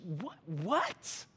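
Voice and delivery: stunned, disbelieving double-take; mock-appalled, rising "what?"; acted disbelief without raising the volume; indignant. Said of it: "That's the same idea here, this indignant".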